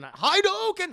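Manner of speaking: high, loud attack call; Ryu. Retold or imitated. Streamer quoting a fighting game to chat, high battle shout. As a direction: shout it like launching a special move. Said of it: "for, for Ryu and Street Fighter".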